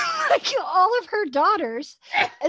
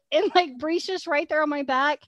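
ordinary talk, nothing marked

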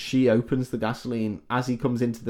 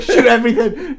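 mock trigger-happy cop; 12 semitones higher, 10 dB louder than usual. Police firing wildly, parody.

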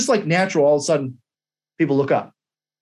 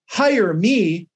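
hire me lifted above the line; raised voice on hire me. Shown as a demonstration. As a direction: lift your voice on hire me. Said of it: "I raised my voice".

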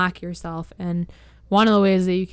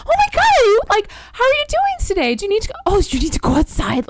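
squeaky, very high pet-owner gushing; imitating high-pitched puppy talk, easing down at the end; baby talk to a dog; 14 semitones higher, 3 dB louder, faster than usual. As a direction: coo at a little dog, pitched way up. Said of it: "we talk to her up".

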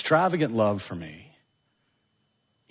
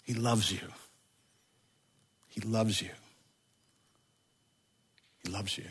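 softer with each repeat until barely audible; fading whisper-soft; 3 semitones lower, 12 dB quieter than usual. Real, not acted. Pastor assuring the congregation of God's love, ever softer. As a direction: let it fade to almost nothing. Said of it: "repeated, fading to a hush".

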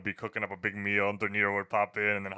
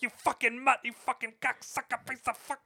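high, hammed-up snarl of curses; Pesci's character cursing someone out; mock gangster rage; 14 semitones higher than usual. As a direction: spit the insults like a movie mobster. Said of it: "he was just like".

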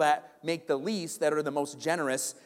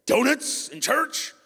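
slow, high, louder incredulous outrage; outraged; 10 semitones higher, 4 dB louder, much slower than usual. Retold or imitated. Angry visitor objecting to donuts in church, slow outraged disbelief. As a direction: drag it out in disbelief. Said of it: "he was really angry about it".

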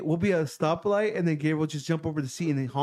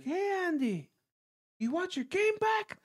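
high, sing-song teasing call; funny taunt; 4 dB quieter than usual. Retold or imitated. Friend baiting Andy with his game, funny high taunt. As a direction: imitate a playful high-pitched taunt. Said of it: "Like that, all funny".

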